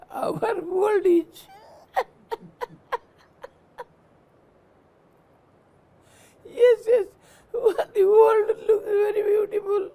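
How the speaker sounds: high, laughing through the irony; amused laughing moral. Real, not acted. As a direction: chuckle at the world's blindness.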